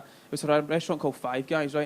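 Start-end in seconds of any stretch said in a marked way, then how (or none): none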